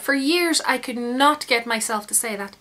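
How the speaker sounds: ordinary talk, nothing marked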